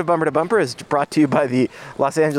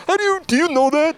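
high incredulous mock voice, much higher; mock conspiracy nut; 12 semitones higher than usual. Mocking a conspiracy theorist, high incredulous voice. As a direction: squeak it out like a know-it-all.

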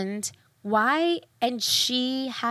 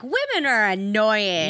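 slow mock casual voice; mock casual sexist; 4 dB louder, slower than usual. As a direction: drawl it like a sixties chauvinist. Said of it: "they're so casually just like".

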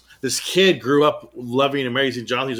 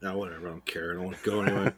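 mock sulk; 6 semitones lower, 10 dB quieter, much faster than usual. Mock sulking after rejection.

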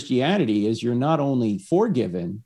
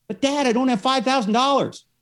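desperate daughter; 13 semitones higher than usual. Pretend. Daughter in court pleads with her judge father, high and desperate. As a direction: beg Dad for help, voice pitched up.

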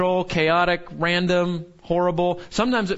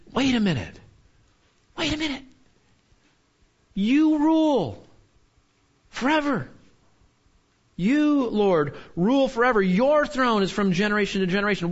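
dawning realization; 6 semitones higher than usual. Acted. Jeremiah speaking for the people as they realize, awed and high. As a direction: voice the people suddenly grasping it. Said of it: "What the writer is saying".

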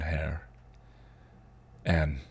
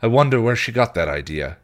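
mild snap; 5 semitones higher, 7 dB louder than usual. Acted. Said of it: "he snapped".

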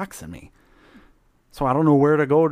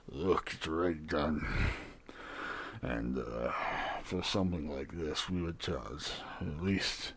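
low gruff barkeep; 9 semitones lower, 7 dB quieter, slower than usual. Acted. Barkeep haggling.

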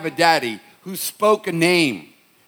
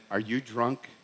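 quiet question; 5 semitones lower, 9 dB quieter than usual. Retold or imitated. Mom questions son late at night.

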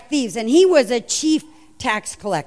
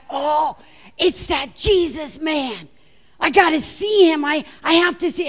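Zacchaeus; 4 semitones higher than usual. Acted. Short tax collector spotting Jesus at the gates, excited. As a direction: burst out, desperate to get a look. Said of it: "Zacchaeus is like".

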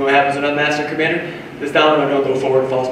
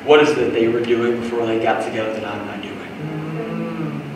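soft, lower, searching prayer; quiet humble prayer; 4 semitones lower, 6 dB quieter than usual. Retold or imitated. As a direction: ask God gently. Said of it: "stop to ask and go".

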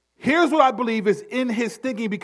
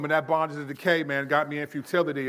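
mock casual chat; 5 semitones lower, 4 dB quieter, faster than usual. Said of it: "we don't talk like that".

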